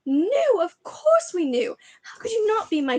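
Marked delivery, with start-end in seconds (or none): 0.0-0.6 full shriek. Acted. Petunia, finally exploding at Harry, shrieks "Knew?". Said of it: "shrieked Aunt Petunia suddenly".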